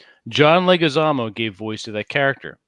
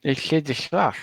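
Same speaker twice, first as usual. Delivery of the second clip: slurred lisping sloth voice, higher; cartoon sloth voice, words mushed together; drunk Sid the Sloth; 3 semitones higher, 4 dB quieter than usual. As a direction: slur it like a drunk sloth with food in his cheeks. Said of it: "it talks like this".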